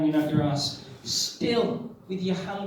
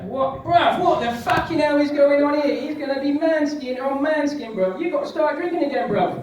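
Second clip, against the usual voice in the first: much higher, louder shouting inner voice; Trevor shouting; 8 semitones higher, 6 dB louder than usual. Acted. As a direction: bark at yourself like a London lad. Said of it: "Trevor's like".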